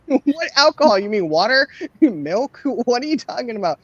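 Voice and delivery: what, alcohol? asked sky-high and baffled; high incredulous disbelief. Retold or imitated. Mom hearing her son claims to drink, high disbelief.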